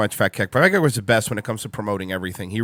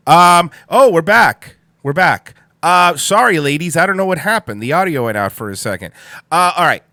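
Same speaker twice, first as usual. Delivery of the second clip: loud, raised announcing voice; higher and louder, feigning innocence; mock back on air; 5 semitones higher, 7 dB louder than usual. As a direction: pop back up brightly as if the sound just returned. Pretends audio just came back.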